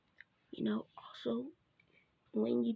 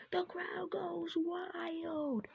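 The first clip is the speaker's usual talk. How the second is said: crowd goes wild; 9 semitones higher, much slower than usual. Pretend. Announcer flourish.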